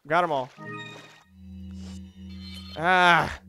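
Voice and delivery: ordinary talk, nothing marked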